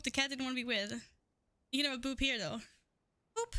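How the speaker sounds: ordinary delivery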